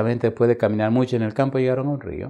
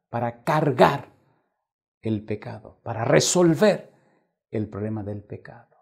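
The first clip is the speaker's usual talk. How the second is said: loud, slow preaching of the verses; full-force pulpit reading; fervent scripture preaching; 3 semitones higher, 7 dB louder than usual. Acted. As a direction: proclaim each phrase to the crowd.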